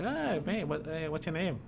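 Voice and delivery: put-on Irish, pitched well up; Irish accent. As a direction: imitate an Irish guy chatting up a stranger. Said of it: "the Irish guy is like".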